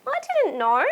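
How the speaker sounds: high sorry little voice; Molly voice. Acted. Molly apologising to Marvin, high apologetic voice. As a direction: say it like a small apologetic friend. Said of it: "said Molly".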